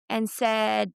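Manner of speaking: normal talking voice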